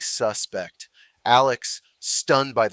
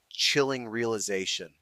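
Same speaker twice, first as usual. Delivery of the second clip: ordinary delivery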